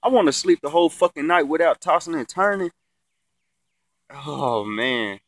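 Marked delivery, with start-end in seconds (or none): none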